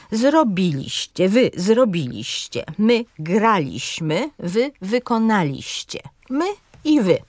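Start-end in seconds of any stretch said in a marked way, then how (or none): 0.1-6.0 measured demo, weight on the third-last syllable in "zrobiliście", "graliśmy", "wykonaliście"; stress third from end. Shown as a demonstration. Teacher reciting model past-tense forms, careful antepenultimate stress. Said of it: "Na trzecią sylabę od końca akcent pada".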